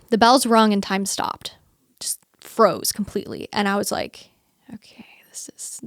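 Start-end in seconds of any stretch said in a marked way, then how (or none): 4.7-5.8 barely voiced whisper; stunned whisper. In the pew as time freezes, stunned whisper. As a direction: breathe it out in disbelief.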